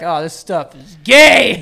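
drawn out and loud on the last word; mock disdain. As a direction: imitate a scornful kid, getting loud. Voices the unborn daughter's scorn.

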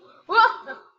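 loud high villain cackle; evil laugh. Pretend. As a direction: cackle over the defeated hero. Boss laughs after threat.

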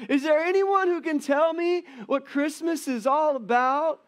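high, loud, slow pleading cartoon kid; desperate Charlie Brown. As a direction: beg the question like a despairing boy. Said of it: "And then he says this phrase".

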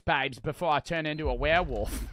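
hammed-up Australian lover; mock Australian accent. Gamer as Nick begging babes for a kiss, mock Australian accent. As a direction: plead jokingly in an Australian accent. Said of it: "he's Australian when I hear him talk".

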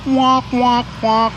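usual speaking voice

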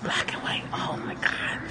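hushed whisper, amazed. Real, not acted. Learning the dog's colour, a stunned whisper. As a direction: breathe it out in awe.

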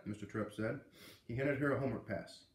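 usual speaking voice

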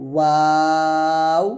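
ওয়াও drawn out, starting and ending on rounded lips; rounded-lip letter. Shown as a demonstration. Teacher demonstrates ওয়াও, rounded and held long. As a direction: stretch ওয়াও slowly from w to a round "oo". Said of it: "পড়তে হবে".

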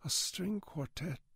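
high, soft, disembodied wonder; a haunted, faraway echo of a question; far away, haunted. A narrator's reading. Janitor freezing at mention of the quartet, haunted. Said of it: "The voice was far away, haunted, disembodied".

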